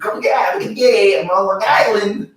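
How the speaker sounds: put-on New York-area accent, thick and performed; Long Island accent. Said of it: "Why are you saying Boston?".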